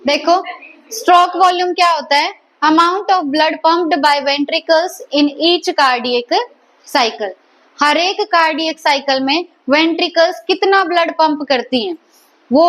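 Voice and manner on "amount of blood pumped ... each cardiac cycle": very slow, word by word, for notes; dictating at writing speed; slow dictation. Shown as a demonstration. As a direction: spell it out slowly so students can write. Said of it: "teacher dictating a definition".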